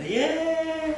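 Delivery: squealed high cheer; gleeful nobles cheering. Retold or imitated. Nobles cheering as the body sinks, high squeal. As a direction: cheer like it's finally over.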